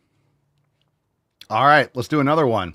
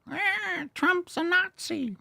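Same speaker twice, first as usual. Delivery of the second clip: very high, soft mock whine; mocking a liberal, high whiny voice; 6 dB quieter, slower than usual. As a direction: whine it like a caricature. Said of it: "you guys don't like that".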